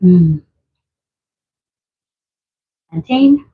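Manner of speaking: usual speaking voice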